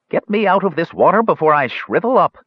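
put-on impatient old man's order; commanding. A narrator's reading. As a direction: demand it, testy and high. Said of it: "ordered the Ancient One".

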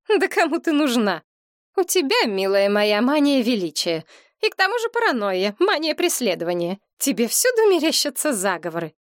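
high, dismissive mock laugh in the voice; scoffing. A narrator's reading. Mother scoffing at her daughter's fears, high and dismissive. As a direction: brush her off with scorn. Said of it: "Мать делано усмехнулась".

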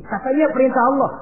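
high, emphatic reply; insistent answer. Acted. Devil insists to Ismail. (meyakinkan dengan nada tinggi)

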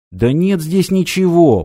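nearly shouting, voice up high; almost yell it in protest. A narrator's reading. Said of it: "почти закричал Стив". (почти крик)

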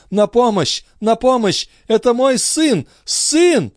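very high desperate calls for help; mother crying for help for her son, very high; heartrending cry. Acted. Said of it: "С душераздирающим криком Андре".

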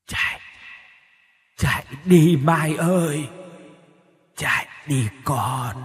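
a mother's ghost calling from far away, hollow and drawn out; distant ghostly voice. Acted. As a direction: echo it as if from another world, slowly. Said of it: "tiếng nói như từ nơi xa xăm nào đó vọng tới".

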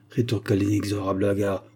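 usual speaking voice

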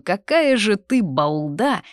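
drawn-out sisterly jibe; drawled. A narrator's reading. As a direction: stretch out the teasing insult. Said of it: "протянула Дезра".

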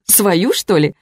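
mild mock-incredulous amusement; amused, laughing. A narrator's reading. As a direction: ask it as if overcome with mirth. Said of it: "я расхохоталась".

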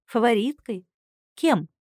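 plain reading voice

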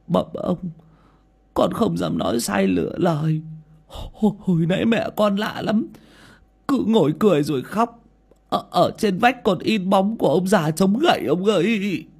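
high, stuttering frightened plea; frightened stammer. Acted. Servant boy telling his master what he saw, frightened stammer. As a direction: stammer out the story in fear. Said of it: "lắp bắp kể lại".

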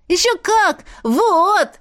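high, proud and excited; proud boy. Acted. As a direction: show off the jars proudly. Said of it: "с гордостью продемонстрировал".

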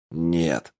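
understated, unyielding refusal; iron firmness. A narrator's reading. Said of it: "железными нотками сказал Кураж". (с железными нотками)

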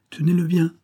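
high, loud urgent order; ordre crié. A narrator's reading. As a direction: shout it out to someone helping you. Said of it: "cria-t-il". (ordre crié, voix haute et forte)

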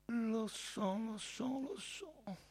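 very soft, high and slow; impassive. A narrator's reading. Caifa answers Pilate's threat, impassive and hushed. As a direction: murmur it slowly, unmoved. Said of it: "Caifa rispose impassibile".

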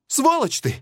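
high furious yell of an insult; angry shout. Acted. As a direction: scream it in rage, voice jumping high. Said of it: "крикнул биолог".

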